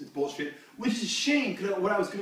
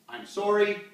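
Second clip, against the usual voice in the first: ordinary talk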